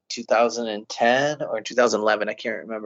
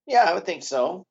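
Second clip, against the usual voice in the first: usual speaking voice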